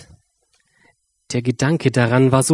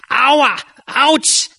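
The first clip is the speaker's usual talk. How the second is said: pained ouch; 13 semitones higher, 3 dB louder than usual. A narrator's reading. Mouse squashed and trodden on.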